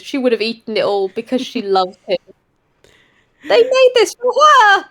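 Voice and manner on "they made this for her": 1 gushing. A narrator's reading. Narrator gushing.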